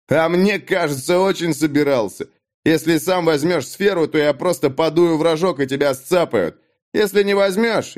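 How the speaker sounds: Dag, threatening bully. A narrator's reading. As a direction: toy with him before the blow. (Даг угрожает Натану, злорадно)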